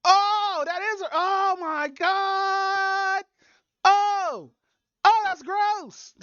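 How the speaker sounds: high slow shriek of disgust. Real, not acted. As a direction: drag out the horror. Reacting to gross clip.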